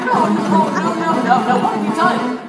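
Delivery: loud, high, flustered objection; horrified narrator halting the show; alarmed protest. Acted. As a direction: cut them off in loud dismay. Narrator stops the hijacked poem.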